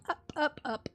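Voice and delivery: usual speaking voice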